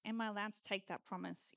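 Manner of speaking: soft and fast, hesitant; tentative. Retold or imitated. Believer asking God permission, soft and quick. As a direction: rush it quietly, unsure. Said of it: "kind of tentatively going up".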